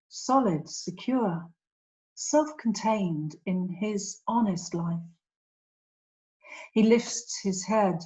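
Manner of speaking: measured poem recital with long pauses between phrases; poetry reading. Acted. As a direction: read it as verse, lingering on each image. Reads own poem aloud.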